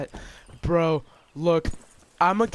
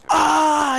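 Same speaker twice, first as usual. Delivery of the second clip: high loud cry of shock; startled cry; 11 semitones higher, 8 dB louder than usual. Real, not acted. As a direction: yelp as if just shot. Getting hit in game.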